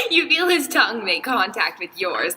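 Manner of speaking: real laughter breaking into the words; laughing while reading. Real, not acted. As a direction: fight through giggles as you read. Reader cracks up while reading.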